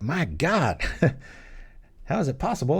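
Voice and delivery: high, swooping disbelief; hammed-up amazement; mock astonishment. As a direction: play a baffled viewer, pitch leaping up. Imagined viewer's astonished reaction.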